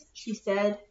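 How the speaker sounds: ordinary delivery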